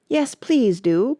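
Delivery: slow, high, begging plea; pleading. A narrator's reading. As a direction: plead with them. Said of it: "pleaded Alice".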